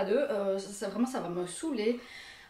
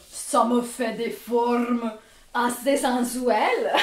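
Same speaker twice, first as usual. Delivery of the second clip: slow, loud, drawn-out show-off; playfully sultry; 6 dB louder, much slower than usual. Pretend. Vlogger showing her curves to viewers, playfully drawn out. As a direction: stretch it out in mock seduction.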